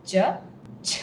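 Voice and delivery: ch with a strong puff of air; aspirated ch. Shown as a demonstration. Teacher drilling the aspirated ch sound. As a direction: breathe hard through the ch. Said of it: "has a lot of air coming through".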